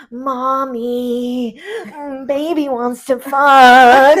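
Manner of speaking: put-on slow, loud, whiny baby voice. Frank in baby mode calling for mommy, a whiny baby voice. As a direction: whine it like a huge toddler. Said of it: "baby mode".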